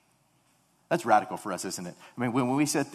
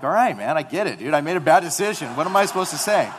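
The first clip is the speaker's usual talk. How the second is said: exasperated reply; 6 semitones higher, 10 dB louder than usual. Retold or imitated. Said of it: "And I'm like".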